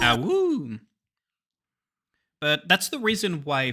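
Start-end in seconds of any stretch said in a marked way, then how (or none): 0.0-0.8 howling like a wolf pup. Pretend. Echoes a wolf howl alert.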